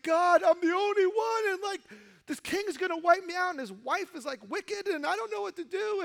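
high, loud whining complaint; whiny Elijah. Retold or imitated. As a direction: whimper like a self-pitying prophet. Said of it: "his pity party".

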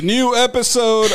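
loud, slow herald cry; town crier. Pretend. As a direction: announce it like a crier. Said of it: "yells at you in the morning".

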